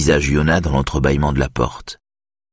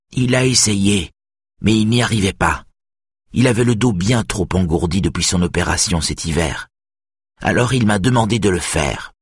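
higher-voiced, mildly grumpy old woman; sullen; 5 semitones higher than usual. A narrator's reading. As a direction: explain it with a touch of sullenness. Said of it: "et dit ensuite d'un ton maussade". (ton maussade)

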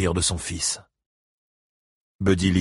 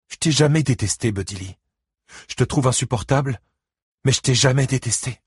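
higher, emphatic denial; protesting ex-wife; 6 semitones higher than usual. Acted. Christine denies ever hating her ex-husband, high and insistent. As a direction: set him straight with exasperated fondness.

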